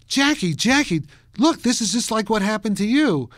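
high, loud, eager mother's call; mother calling out excitedly. Retold or imitated. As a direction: call to your son with excited news. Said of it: "She says".

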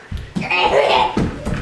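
low, voiceless creepy growl; pretend scary growl. Child playfully threatening viewers who don't subscribe, scary growl. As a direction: growl like a monster under the bed. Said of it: "Super scary".

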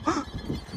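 sudden breathless gasp of fright; sharp frightened gasp. Real, not acted. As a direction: gasp in alarm. Man with chainsaw approaching.

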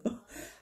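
short breathy unvoiced laugh; stifled laugh. Real, not acted. Laughing at the till price, stifled breathy chuckle. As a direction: let a quick silent laugh escape. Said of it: "I started laughing".

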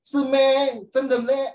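high, loud disbelieving squeal; mock surprise. Teacher acting a shocked student, high and loud. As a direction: squeak it out, amazed.

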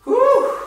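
high loud whew of effort; loud whew exhale. Real, not acted. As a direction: blow out a big whew. Instructor exhaling during workout.

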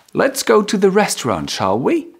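a cheerful suggestion, "shall we?" sung upward. Shown as a demonstration. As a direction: pitch it up and let "shall we?" climb. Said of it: "Higher pitch".

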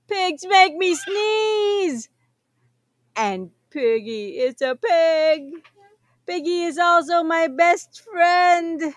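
upset whining. Acted. Upset character complaining.

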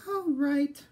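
high-pitched little piglet voice, lightly sighed; a small pig giving in, pitched high; sighing piglet. A narrator's reading. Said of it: "sighed the piglet".

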